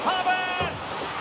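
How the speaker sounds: "Havertz!" flung up high and held; excited name call. Acted. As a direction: call the name as the shot goes in. Commentator calls shot on goal.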